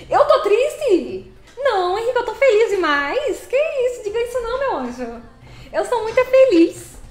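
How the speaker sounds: high, loud exaggerated cheer; mock cheer. Streamer answers Henrique's comment with loud mock cheer. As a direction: overplay being happy for the chat.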